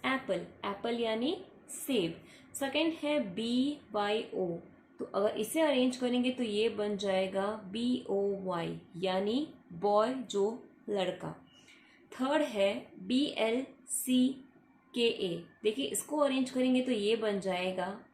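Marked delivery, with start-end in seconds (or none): 2.5-4.6 slow spelling. Shown as a demonstration. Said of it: "teacher spells letters slowly". 12.1-15.5 letter by letter, drawn out; spelling a jumbled word for the class, slowly. Shown as a demonstration. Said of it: "teacher spells letters slowly".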